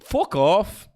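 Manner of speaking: put-on a loud high Irish-accented curse. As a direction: try the Irish accent again. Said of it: "That wasn't Irish".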